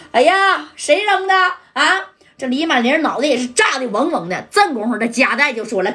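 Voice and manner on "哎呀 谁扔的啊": high startled cry, dazed; shocked cry. Acted. Li Manling reels after the blast, high shocked yelp. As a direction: yelp it as if your ears still ring.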